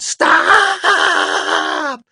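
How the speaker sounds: piercing, high, long-held scream; child's all-out shriek; yelling as loud as possible. A narrator's reading. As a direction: scream it at full blast. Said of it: "yelled as loud as she could".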